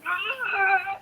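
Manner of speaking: high, drawn-out imitated scream; mock labour scream. Mother biting on the gas mouthpiece, mock screaming.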